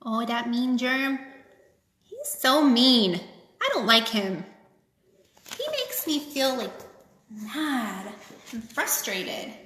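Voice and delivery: playful grumbling at a bad guy; teasing child-like dislike of the germ; mock disapproval. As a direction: pout it out for the little ones. Aside mocking the germ for kids.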